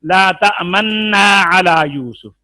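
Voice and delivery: तमन्ना with a long held nasal "nn"; slow, loud recitation holding the doubled n in तमन्ना; first way of reciting. Shown as a demonstration. Teacher showing students the first reading, तमन्ना held long and loud. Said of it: "यहाँ पर तीन तरीकों से पढ़ सकते हैं".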